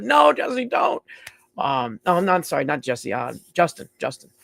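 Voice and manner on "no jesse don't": sudden high, loud shout of alarm; retold yell. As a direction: yell a warning at the screen. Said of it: "yelling at the monitor".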